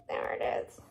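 whispered happy find. Real, not acted. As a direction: whisper it like a secret. Found the X again.

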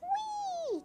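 very high, held whee; baby's gleeful cry. A narrator's reading. Child reading baby in the swing, high squeal. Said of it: "cries the baby in the swing".

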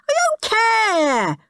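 whiny moan. A narrator's reading. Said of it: "moaned horrid Henry".

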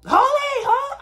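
very high loud "holy, holy"; excited squeal. Retold or imitated. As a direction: blurt it out starstruck. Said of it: "I said holy".

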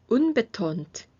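slow model reading with gaps to repeat; slow repeat-after-me. Shown as a demonstration. Teacher leading a review, students repeat each term. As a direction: say each term slowly so students can echo it. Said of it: "소리 내어서 따라 읽어보세요".

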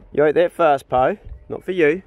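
loud, firm telling-off of a greedy dog; firm call to dog. Real, not acted. Owner loudly warns Poe off the food, firm. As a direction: raise your voice to shoo the dog away.

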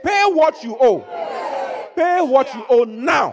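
high furious shout; slow, shouted pay what you owe; angry demand. Acted. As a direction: seize him and demand payment. Servant grabs debtor's throat.

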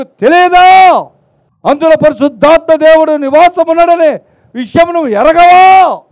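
loud, high fervent sermon delivery; preaching at full force. Acted. Pastor exhorts the congregation, loud and fervent. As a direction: thunder it from the pulpit. Preacher drives home the point.

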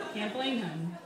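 ordinary talk, nothing marked

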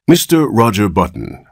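plain reading voice

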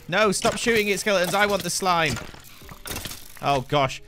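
ordinary talk

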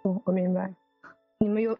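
usual speaking voice

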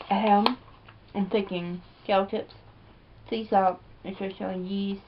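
speaking between bites; talking while chewing. Real, not acted. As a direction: mouth partly full. Seen 3: Chip put in mouth at -2; lips pressed, chewing while talking.

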